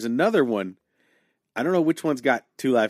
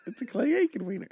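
surprised gasp; 11 semitones higher, 7 dB quieter, faster than usual. Retold or imitated. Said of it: "It's like".

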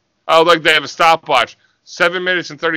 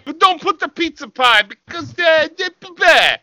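much higher cartoon tough guy; mock meathead; 11 semitones higher than usual. Co-host voicing Vito about his gear, high and hammed-up. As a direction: stumble through it like a dim wrestler.

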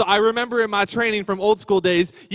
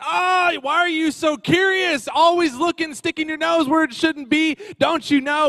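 mock scolding; 11 semitones higher, 5 dB louder than usual. Scolding the dog.

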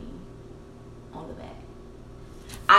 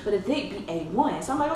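ordinary delivery

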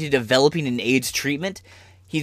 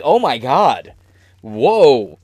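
mock shock; 6 dB louder, much slower than usual. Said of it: "and I'm like".

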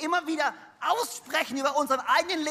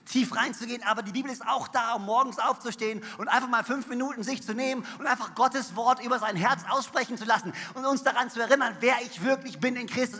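usual speaking voice

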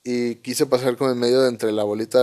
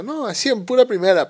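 high, louder nervous refusal; scared protest; 11 semitones higher, 5 dB louder than usual. Retold or imitated. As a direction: protest with panic in the voice. (protesta nerviosa, aguda y fuerte)

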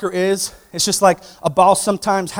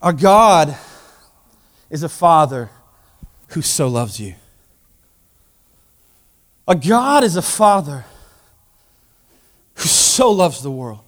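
earnest preaching; 3 dB louder than usual. Acted. Preacher telling the congregation God loves them, sincere. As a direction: land each phrase gently.

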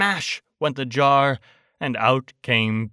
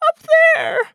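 very high, loud squeak; tiny voice in a panic; frightened Piglet; 7 dB louder than usual. A narrator's reading. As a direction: squeal it out like a scared little pig. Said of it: "said Piglet".

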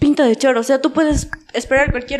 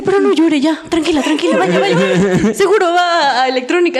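soothing guard; 4 semitones higher than usual. Retold or imitated. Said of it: "me dice".

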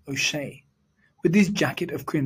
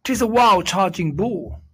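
higher, louder mock alarm; shout; 6 semitones higher, 5 dB louder than usual. A narrator's reading. Leprechaun faking a bull charge, loud mock alarm. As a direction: keep the fake panic going. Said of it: "shouted he".

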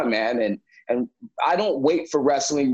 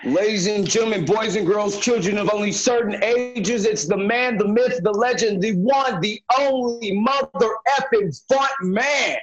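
high booming ringmaster intro; 4 semitones higher than usual. Acted. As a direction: announce it like a circus ringmaster. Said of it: "Make some noise for frontman [name]".

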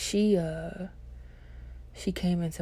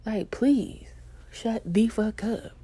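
higher-pitched, slowed-down insistence; emphatic plea; 5 semitones higher, slower than usual. Retold or imitated. Embarrassed student begs friends for silence, slow exasperation. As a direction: spell out the plea, drawn out at the end.

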